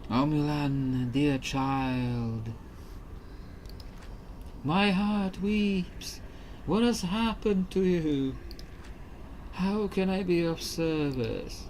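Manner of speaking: put-on a kindly old ghost speaking from beyond. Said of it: "This is my ghost voice".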